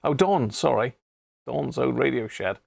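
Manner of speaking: ordinary talk, nothing marked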